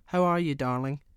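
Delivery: cheery call to her husband. A narrator's reading. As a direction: sing it out to the next room.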